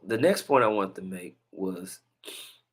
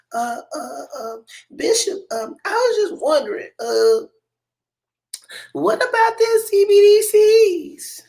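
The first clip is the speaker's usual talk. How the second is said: high, small mock lady's voice; high falsetto church lady asking a question; timid little lady; 9 dB louder than usual. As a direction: squeak it out like a shy church lady. Little lady with baby asking bishop.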